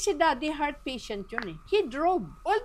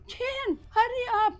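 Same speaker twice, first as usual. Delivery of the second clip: urgent call; 13 semitones higher, slower than usual. Retold or imitated. Urging someone to hurry.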